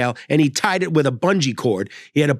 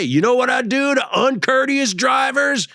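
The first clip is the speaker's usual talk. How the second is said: menacing trucker; 9 semitones higher than usual. Retold or imitated. Trucker taunting the realtor.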